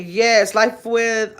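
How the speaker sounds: normal talking voice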